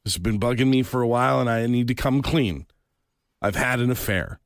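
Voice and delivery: low, subdued husband confessing; imitating a grave admission; mock confession. Husband's confession acted in the story.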